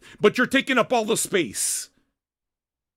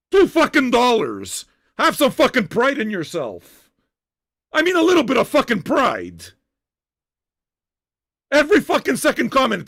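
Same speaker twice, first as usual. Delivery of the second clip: raised, exasperated rant; angry yelling; 3 semitones higher, 4 dB louder than usual. Real, not acted. Host berating non-paying commenters, exasperated yelling. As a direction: scold them loudly for having no pride. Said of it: "why I'm yelling right now".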